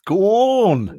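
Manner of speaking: drawn-out Suffolk "Gone" with twisted vowel; Suffolk accent. Shown as a demonstration. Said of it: "they call it, like, the tortured vowel".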